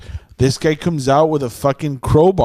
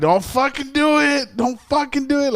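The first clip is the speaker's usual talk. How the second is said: urgent warning; 10 semitones higher than usual. Retold or imitated. Storyteller replays warning the man with the tire iron, high urgent plea. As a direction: re-enact warning someone off at gunpoint. Retelling his warning to the attacker.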